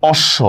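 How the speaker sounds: অশ্ব as "osh-sho"; doubled consonant. Shown as a demonstration. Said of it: "দুইবার উচ্চারণ করতেছি".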